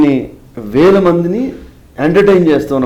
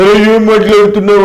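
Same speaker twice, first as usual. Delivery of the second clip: a louder, higher, scolding politician's voice; an impression of a politician scolding someone, loud and raised; 5 semitones higher, 6 dB louder than usual. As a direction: bark it out like a riled politician. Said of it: "పొలిటికల్గా డిఫరెంట్గా ఉండే వాయిస్లు".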